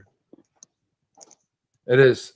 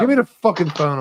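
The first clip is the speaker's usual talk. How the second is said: angry demand; 7 semitones higher than usual. Real, not acted. Demanding the phone mid-argument.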